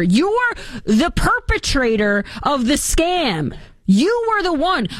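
high, slow accusing outburst; indignant accusation. Real, not acted. Host accuses Jill Biden, indignant. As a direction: lean into the outrage.

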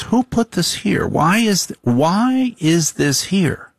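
high, dragged-out complaint as the wife; nagging wife. Retold or imitated. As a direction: whine it like a wife finding clutter. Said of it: "She goes".

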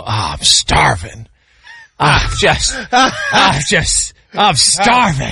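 hammed-up groaning complaint, drawn out; imitated starving dad. Dad making a spectacle about eaten food, hammed-up starving groan. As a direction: make a big spectacle of hunger. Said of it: "he would make a big spectacle about it".

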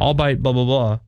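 ALBEIT as "all-bite", then blah-blah; mispronunciation. Retold or imitated. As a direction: quote someone saying "albeit" as "all-bite". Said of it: "Like, no, no, it's albeit".

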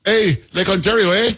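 high, hammed-up Canadian accent leaning on Hey and eh; exaggerated Canadian accent. Host mocks the Ontario premier with a hammed-up Canadian accent. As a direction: play the cheerful Canadian cliché. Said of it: "that's a spot-on impersonation of this guy Ford".

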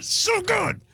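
very high excited squeal; high-pitched rave; gushing. Real, not acted. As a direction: gush in a piercingly high pitch. Gushing about the show.

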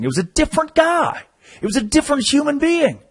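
very high-pitched incredulous outburst; exasperated disbelief. Retold or imitated. Host reveals the lead singer had been replaced, high-pitched disbelief. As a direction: exclaim it sky-high, as a scandalous discovery. Punchline of missing singer story.

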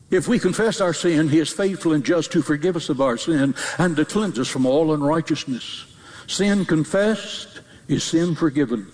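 ordinary talk, nothing marked

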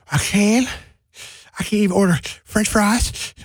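put-on high, thrilled kid's voice; a little boy who can't believe he can order fries; excited kid. Kid voice amazed at food delivery.

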